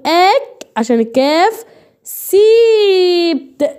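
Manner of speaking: "اك" ends in k, then "سيبت" long and high; syllable-by-syllable demonstration. Shown as a demonstration. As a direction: draw out "سيبت" after a separate "اك". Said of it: "يبقى اما اجي انطق هقول".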